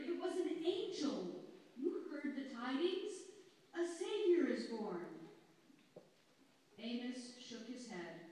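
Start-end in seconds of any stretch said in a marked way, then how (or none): 0.0-5.3 higher, louder excited urging; crying out. A narrator's reading. Eldest shepherd urging Amos to Bethlehem, raised excited voice. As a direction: tell him the news with fervour. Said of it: "cried the eldest shepherd".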